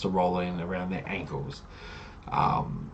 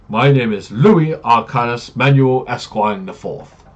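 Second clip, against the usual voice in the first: slow, loud, grand self-introduction; pompous introduction; 4 semitones higher, 8 dB louder, slower than usual. Acted. Paladin announcing his full title to the party, slow and grand. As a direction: roll out every name like a royal herald.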